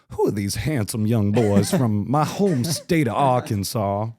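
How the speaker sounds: slow, drawn-out Alabama drawl; Alabama accent. Retold or imitated. Host greeting the band from Arkansas in an Alabama accent. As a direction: ham up a Southern Alabama twang. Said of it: "I'm speaking like I'm from Alabama".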